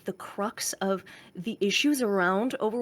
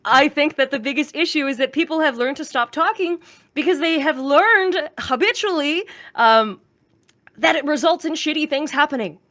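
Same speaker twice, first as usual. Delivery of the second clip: louder, higher, emphatic; pressing the point hard; heated point; 5 semitones higher, 5 dB louder than usual. Real, not acted. Streamer venting about ranked, heated and loud.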